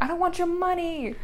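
high-pitched mock protest; retold protest. Student refusing a visitor's crumpled bills, high protesting voice.

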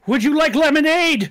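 loud, high-pitched yelled offer; shouting into the air. Acted. Lemonade seller alerting hidden friends, shouting. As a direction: shout it out like a signal. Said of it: "He starts shouting it into the air".

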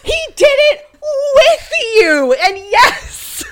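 high, loud, cracking exclamation; nearly shrieking with outrage; loud and high, almost crying out; indignant outburst. Real, not acted. Heated outburst about cheating.

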